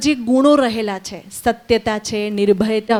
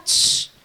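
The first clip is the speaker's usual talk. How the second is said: long soft "shh"; hushing shush; 6 dB quieter, slower than usual. Real, not acted. Teacher quieting a child during the story, hushing shush.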